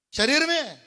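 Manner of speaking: శరీరమే said loud and high, punched out; emphatic. Shown as a demonstration. Pastor answering his own question, శరీరమే loud and emphatic. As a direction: hit శరీరమే hard and loud. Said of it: "పాపం దేంతో చేస్తామండి?".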